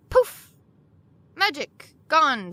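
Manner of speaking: sudden high, loud "Poof!" like a magic trick; a sharp playful burst of a poof; mock magic poof. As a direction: pop the word up high and bright like a conjurer.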